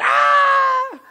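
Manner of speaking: sudden high, loud duck shriek; a piercing yelp of horror; cartoon shriek. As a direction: let out a shocked squawk. Uncle shocked at the card.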